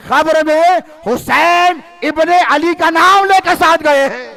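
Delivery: high, loud, slow preaching swell; impassioned preaching climax. Real, not acted. Preacher rousing the crowd, loud and slow. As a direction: rise to a fervent peak, slowly. Preacher building to full force.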